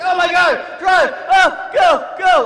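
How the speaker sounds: panicked high-pitched shouting; loud, frantic commander in a panic; freaking out. Retold or imitated. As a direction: scream urgent orders at the driver. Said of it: "my vehicle commander is just freaking out".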